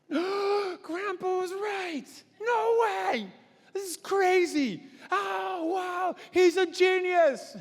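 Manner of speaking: put-on high, slow, awed child voice; children marvelling at the dry riverbed, high and amazed; amazed grandkids. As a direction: gasp it out like a stunned kid.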